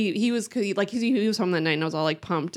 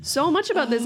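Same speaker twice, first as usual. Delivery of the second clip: exasperated self-questioning; 7 semitones higher than usual. Retold or imitated. Retold exasperated thoughts about herself.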